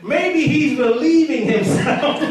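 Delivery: high, slow taunt breaking into laughter; mocking. Elijah messing with the Baal worshipers, mocking. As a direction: draw out the cheeky jab, amused. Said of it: "Elijah was messing with him".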